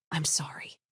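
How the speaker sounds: breathy whispered I'm sorry, sobbing under it; barely a breath, voice gone from crying; whispered apology. A narrator's reading. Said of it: "Talia was sobbing now".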